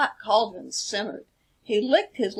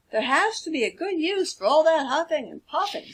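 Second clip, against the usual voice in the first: higher pig voice; thoughtful pig Brad; 7 semitones higher, faster than usual. Acted. Brad wonders about the wolf's huffing in a higher voice. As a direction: muse on the idea. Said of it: "said Brad".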